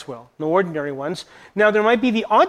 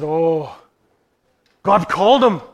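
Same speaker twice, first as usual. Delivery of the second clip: Irish brogue; 3 semitones lower than usual. Retold or imitated. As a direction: lightly mimic an old Irish missionary's brogue. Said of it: "at least in her brogue".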